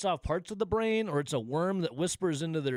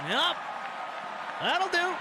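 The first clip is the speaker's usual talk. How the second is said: gleeful. Real, not acted. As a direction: crow it with satisfaction. Gloating after Brewers homer.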